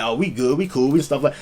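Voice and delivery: low, soft mock of settling couples; complacent mimicry. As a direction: imitate someone lazily content, quiet and low. Mimics couples who just settle.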